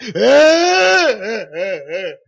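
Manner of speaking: loud, hammed-up wailing; mock sobbing. Showing friend how to cry in the car, mock wailing. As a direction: bawl in big exaggerated bursts. Acting out crying alone in car.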